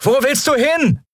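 high called-out question; voiced high, calling across the noise; calling after her. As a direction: call after someone running off. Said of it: "als sie ihr nachrief".

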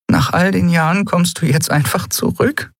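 high, choked-up question; half-choked voice. A narrator's reading. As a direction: ask it with the voice half stifled. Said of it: "mit halb erstickter Stimme". (mit halb erstickter, hoher Stimme)

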